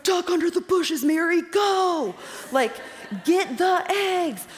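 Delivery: high, loud, slow yelling with a laugh; excited shout. Retold or imitated. Coaching mom through FaceTime egg hunt, laughing shout. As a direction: yell it like cheering from the sidelines. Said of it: "And I was like".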